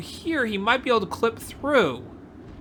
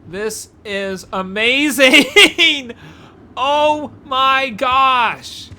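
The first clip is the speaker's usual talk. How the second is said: laughing amazement; 5 semitones higher, 8 dB louder, much slower than usual. Real, not acted. Repeats, louder with laugh.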